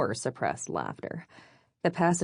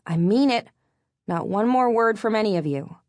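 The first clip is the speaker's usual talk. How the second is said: scolding; 6 semitones higher, 6 dB louder than usual. A narrator's reading. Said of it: "I scolded them".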